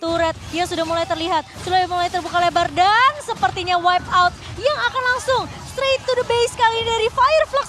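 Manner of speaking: loud, high hype call to the base; fighting game announcer. Acted. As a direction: build the call to the final push. Play-by-play push to the base.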